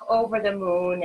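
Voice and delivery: ordinary talk, nothing marked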